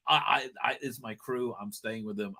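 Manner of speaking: soft, low, steady refusal; hushed, firm loyalty; quiet resolve. Retold or imitated. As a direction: quietly insist on staying with the crew. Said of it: "she's like".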